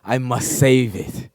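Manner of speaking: dramatic vow. Pretend. Mock dramatic vow, drawn out.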